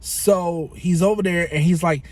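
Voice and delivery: ordinary talk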